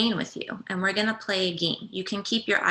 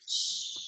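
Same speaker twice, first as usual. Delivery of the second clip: soft unvoiced hush of wind in leaves; gentle whispered shh; whispering wind; 8 dB quieter than usual. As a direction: breathe it out like wind through leaves. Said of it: "It whispers".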